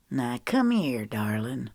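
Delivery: a light country bumpkin drawl, dropping low on darling. A narrator's reading. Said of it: "he drawled in a country bumpkin voice".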